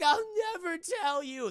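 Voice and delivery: high, loud squeaky defiance; defiant victim. Acted. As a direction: refuse in a squeaky hero voice.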